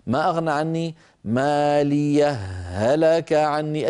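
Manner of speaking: slow melodic tajweed chant; Quran recitation. Acted. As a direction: recite the verse slowly and tunefully.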